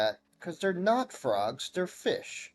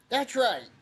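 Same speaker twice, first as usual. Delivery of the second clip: high villager agreement; 9 semitones higher than usual. Acted.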